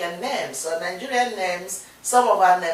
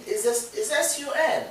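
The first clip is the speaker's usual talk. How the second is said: usual speaking voice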